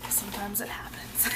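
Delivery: hushed aside; soft unvoiced whisper. Real, not acted. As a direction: whisper it, a little embarrassed. Embarrassed aside to viewers.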